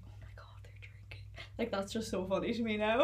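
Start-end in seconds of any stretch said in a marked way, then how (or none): 0.1-1.2 scandalised whisper. Retold or imitated. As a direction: hush it like a shocked secret.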